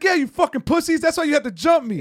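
high, jeering imitated insult; mock taunt. Retelling the man taunting after getting his glove, high mock taunt. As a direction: sneer it back like the heckler. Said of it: "he's like".

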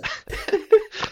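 loud high giggle burst; helpless shrieking laughter; high squealing laugh. Real, not acted. Laughing at a wrong answer.

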